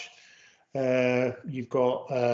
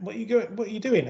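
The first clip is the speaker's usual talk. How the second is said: higher-pitched imitation of officials; questioning customs officers; 7 semitones higher, faster than usual. Customs officers quizzing the van driver, mock questioning. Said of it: "And then they went".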